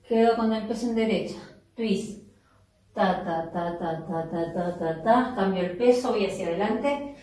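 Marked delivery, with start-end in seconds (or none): 2.9-5.3 even run of low "ta-ta-ta", lifting at the end; rhythmic "ta" count. Shown as a demonstration. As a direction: tap out the dance rhythm on "ta". Vocalises the rhythm of steps. (tarareo rítmico "ta, ta, ta")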